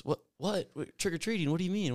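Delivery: high, baffled what-questions; mock surprise. Coach retelling his baffled reaction, high and incredulous. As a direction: act out confused disbelief.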